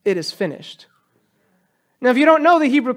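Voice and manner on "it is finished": "It is finished" said softly and falling; a soft, reflective echo; quiet. Shown as a demonstration. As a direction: let it drop, hushed. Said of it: "cried out with a loud voice".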